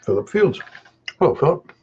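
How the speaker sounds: talking through a mouthful; chewing while greeting; mouth full. Real, not acted. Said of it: "Not polite to talk with my mouth full".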